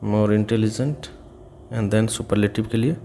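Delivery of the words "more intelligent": more intelligent said very slowly and clearly; slow model reading. Shown as a demonstration. Teacher modelling the comparative for students, very slow. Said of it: "syllable अधिक हो जाता है".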